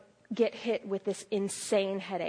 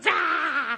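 short startled "Ah!", higher and louder; startled cry; 6 semitones higher, 6 dB louder than usual. Retold or imitated. Preacher mimics her own startled yelp. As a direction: yelp as if hit by slime. Reacting to being slimed.

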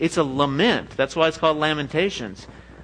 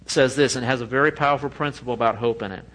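ordinary delivery

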